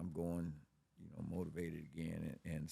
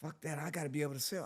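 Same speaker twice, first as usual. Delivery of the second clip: higher, fast put-on girlfriend voice; girlfriend voiced; 9 semitones higher, faster than usual. Girlfriend insisting on selling, higher and fast. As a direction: rattle it off as her. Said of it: "girlfriend voiced again".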